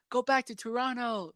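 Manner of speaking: high-pitched heckle, held soft; mock heckling yell. Retelling a wrestling fan's taunt at ringside, mock heckle. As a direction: imitate a fan yelling without real volume. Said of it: "Then a fan yelled out".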